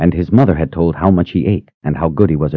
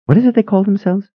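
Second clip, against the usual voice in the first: lightly voiced eager sister; faster than usual. A narrator's reading. Phoebe asks Janet.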